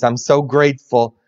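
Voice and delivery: ordinary talk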